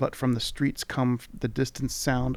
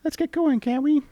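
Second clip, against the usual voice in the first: much higher, pushy sprite voice; impatient tiny creature; 5 dB louder than usual. Acted. Mini mite hurrying the traveller, impatient tiny voice. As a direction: grumble it up high, impatient. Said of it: "it grumbles impatiently".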